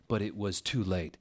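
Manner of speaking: ordinary delivery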